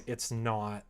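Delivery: normal talking voice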